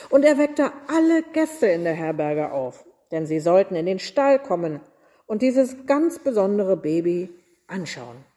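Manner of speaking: usual speaking voice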